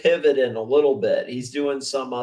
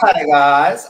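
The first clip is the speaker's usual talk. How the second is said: a louder copy of the YouTuber's greeting; imitating his greeting; 9 dB louder than usual. Streamer imitates the YouTuber's intro, louder. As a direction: echo the clip's "Hi, guys" with a grin. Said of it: "I like how he says".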